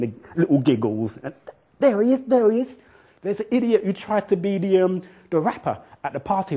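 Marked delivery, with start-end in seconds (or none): 1.8-4.8 high, loud teasing; high mocking girls' taunt; giggling girls. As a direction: tease him like schoolgirls. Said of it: "they pointed at me ... little giggles".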